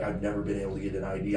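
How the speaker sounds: usual speaking voice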